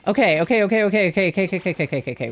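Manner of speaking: words tumbling into a stutter; flustered rapid-fire. Real, not acted. Player just got a girl, flustered rapid-fire repetition. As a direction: speed up until the syllables collapse together.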